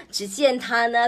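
usual speaking voice

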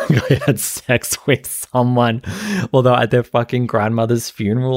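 lightly voiced Raquel, giggling; mocking through laughter. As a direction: parody her line while cracking up. Mocking Raquel's confession while laughing.

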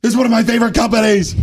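high, loud gushing shout from across the hall; high, shouted fan gushing; excited yelling. Retold or imitated. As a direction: yell it like a fan spotting a favourite brand. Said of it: "I start yelling this".

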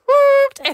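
very high, loud siren wail; imitated police siren. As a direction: wail like a patrol car siren. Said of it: "sirener og uh". (efterlignet sirene, højt hyl)

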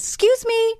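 high-pitched imitation of a small boy; little boy. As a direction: pipe up high like a kid testing it out. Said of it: "one of the little boys tested it out".

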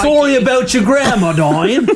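high, hammed-up Australian accent. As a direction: play a breezy cartoon Aussie, close to laughing. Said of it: "but with an Australian accent".

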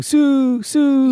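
high, loud "Sue, Sue" chant; imitated crowd chant. Imitating the tennis crowd, high chanting. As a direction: chant it like the back of a stadium. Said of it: "you can hear the crowd going".

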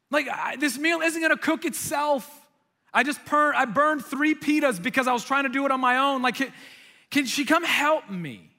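high, loud harried complaint; frustrated. Retold or imitated. Martha burning pitas while cooking alone, frustrated. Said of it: "like, get frustrated".

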